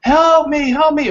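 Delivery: high, loud cry for help; imitated shouting. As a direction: act out a drowning man calling out. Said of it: "So he's shouting".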